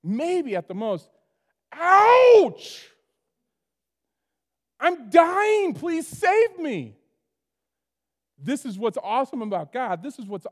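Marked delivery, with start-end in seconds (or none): 1.7-2.9 high loud yelp of pain; hammed-up yelp from the den; mock cry of pain. Mock wounded Daniel in den. 4.8-7.0 high, loud, slow pleading voice; mock dying plea.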